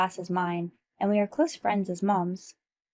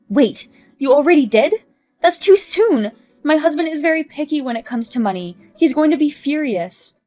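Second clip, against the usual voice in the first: frantic panic; 6 semitones higher, 8 dB louder than usual. Acted. Learns husband was contacted.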